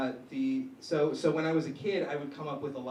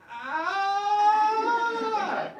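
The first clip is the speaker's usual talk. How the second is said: sudden high startled cry of panic; startled yelp; 8 dB louder than usual. Retold or imitated. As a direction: blurt out a freaked-out yelp. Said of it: "And I just said".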